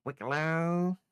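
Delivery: "Wickalo" loud and high, last syllable stretched; name butchered to annoy. Pretend. Co-host teasing someone to make him angry, "Wickalo" loud and mangled. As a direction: blare out "Wickalo" with a long distorted ending. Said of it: "so it makes him angry".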